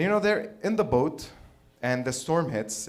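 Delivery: normal talking voice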